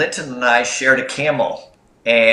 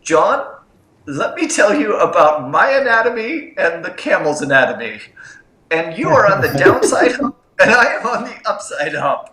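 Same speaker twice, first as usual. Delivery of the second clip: imitated South African accent, raised pitch, like a British explorer; Linton's South African accent; 6 semitones higher than usual. Retelling a camel-mate's complaint to the audience, mock South African accent. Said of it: "in Linton's great South African accent".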